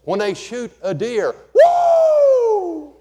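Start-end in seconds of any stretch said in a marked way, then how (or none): 1.5-3.0 high, loud celebratory whoop; hillbilly whoop. Retold or imitated. Hillbilly hunter whooping over a downed deer. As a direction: whoop like a hunter who just got his deer. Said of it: "shouts all around these hills".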